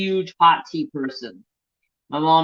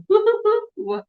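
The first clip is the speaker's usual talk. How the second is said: much higher, louder sing-song woo hoo; Winnie the Pooh; 4 dB louder than usual. Retold or imitated. Spoon in the honey jar, gleeful Pooh whoop. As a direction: whoop like a bear who just found honey. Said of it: "like Winnie the Pooh and go".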